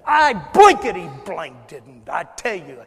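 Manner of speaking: loud, drawn-out mock swearing; Peter's cursing fit. Peter cursing as he denies Jesus, loud mock fury. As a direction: blow up in a hammed-up outburst. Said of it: "in a fit of profanity and cursing".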